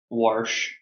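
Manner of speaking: wash said with an R, "warsh"; Midwestern warsh. Retold or imitated. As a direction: put an intrusive R into wash. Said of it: "you guys also say".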